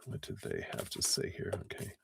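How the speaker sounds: soft unvoiced mutter; hushed self-talk; whispered aside. Real, not acted. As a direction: murmur it to yourself. Muttering while looking.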